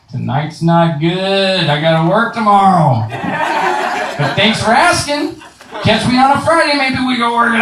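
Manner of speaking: high, rising to a laughing shout; mock polite refusal. Driver cheekily turning down the cop's jail threat, high and laughing.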